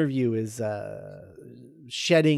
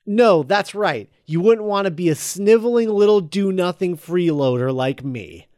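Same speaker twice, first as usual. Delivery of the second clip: loud, raised mock indignation; acted bitter retort; 4 semitones higher, 7 dB louder than usual. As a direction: throw it back as a wounded, sarcastic retort. Acts out Harry's bitter retort.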